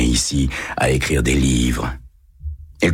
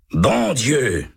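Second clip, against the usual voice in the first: exclaiming; 7 semitones higher than usual. A narrator's reading. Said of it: "s'exclame l'un d'eux".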